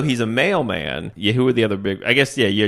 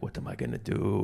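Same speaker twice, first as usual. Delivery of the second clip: quiet despair; 4 semitones lower, 14 dB quieter than usual. Retold or imitated. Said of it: "staring at the ceiling like".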